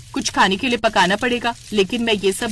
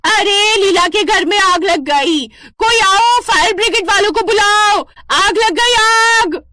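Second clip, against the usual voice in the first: much higher, loud cries of fire; shouting alarm; 13 semitones higher, 6 dB louder than usual. Acted. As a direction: raise the alarm for the whole street. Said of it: "जोर जोर से शोर मचाने लगती है".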